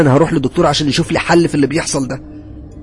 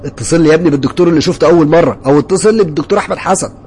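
loud, raised, demanding order; angry yelling; 6 semitones higher, 7 dB louder than usual. A narrator's reading. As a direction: demand it furiously from the receptionist. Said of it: "قلت له بغضب وبزعيق". (زعيق بغضب)

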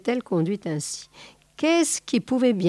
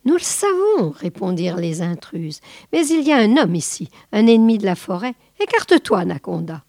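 vipers' voice; faster than usual. Acted. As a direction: pitch up and rush it like agitated snakes.

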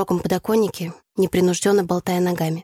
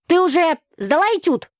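high, calling voice; shouted question; 11 semitones higher, 4 dB louder than usual. A narrator's reading. Classmate asks a girl about her exam from afar, shouting. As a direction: shout it across the corridor. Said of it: "Кричал наш одногруппник".

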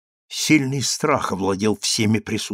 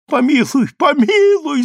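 very high desperate begging; pleading cry; 13 semitones higher, 4 dB louder than usual. A narrator's reading. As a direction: cry out for mercy, pitched way up. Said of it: "закричала Скулика".

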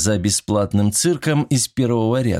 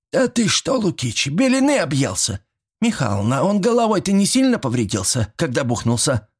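high-pitched granny outrage; indignant old woman; 7 semitones higher than usual. A narrator's reading. Old woman asks if the chairman lost his mind, indignant. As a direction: squawk in disbelief at the accusation. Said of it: "возмутилась Степанида".

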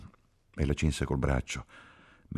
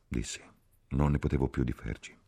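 usual speaking voice

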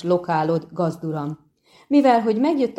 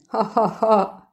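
mocking ha-ha laugh; 4 semitones higher, slower than usual. Bard mocks the halfling.

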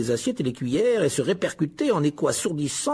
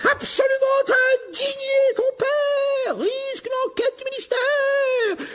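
furious screaming mother. A narrator's reading. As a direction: let the howler blast the whole hall. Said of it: "la voix qui lui perçait les tympans".

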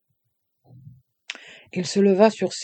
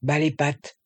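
ordinary delivery, nothing marked

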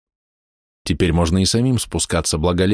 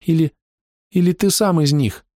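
put-on young boy voice; 10 semitones higher than usual.

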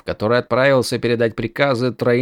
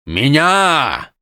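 shouting; 3 semitones higher than usual. A narrator's reading. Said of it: "прокричал он".

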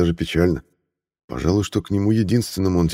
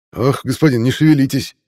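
high, loud irritated cry; exclaiming irritably; 8 semitones higher, 5 dB louder than usual. A narrator's reading. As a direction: snap at him to hold still. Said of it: "воскликнул недовольный цирюльник".

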